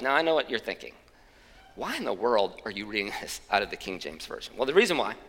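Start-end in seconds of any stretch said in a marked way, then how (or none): 1.8-4.5 low, soft sceptical aside; imitated inner doubt. Preacher voices congregation questioning his Bible choice, low mock doubt.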